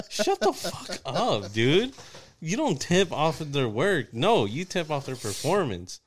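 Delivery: heated. Real, not acted. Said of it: "I'm heated up right now".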